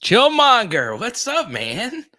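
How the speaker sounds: loud, high-pitched shout-out to a buddy; excited greeting. Real, not acted. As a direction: call out the name high and loud, then "what's up". Calling out a greeting to a viewer.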